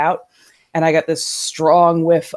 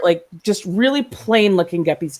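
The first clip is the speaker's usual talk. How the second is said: usual speaking voice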